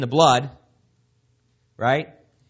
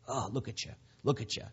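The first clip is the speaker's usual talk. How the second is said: much softer mocking "look at you"; mocking accuser; 3 semitones lower, 12 dB quieter, faster than usual. Accuser mocking the believer, soft and scornful. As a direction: sneer it softly, almost under the breath. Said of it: "the accuser is right there".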